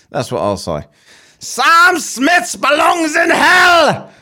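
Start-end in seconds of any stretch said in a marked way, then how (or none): 1.4-4.1 a hammed-up accent that drifts toward Chinese; botched foreign accent. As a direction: bellow it like a bad put-on foreigner. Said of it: "that's the best I could do".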